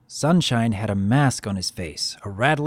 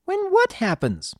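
high, puzzled filly voice; bewildered. A narrator's reading. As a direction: ask in a child's confused squeak.

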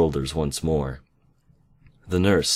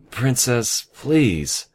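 higher-pitched, sighing plea; weary sigh of a plea, pitched up; 7 semitones higher, much slower than usual. A narrator's reading. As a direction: plead with the princess through a sigh. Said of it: "Band-Aid sighed".

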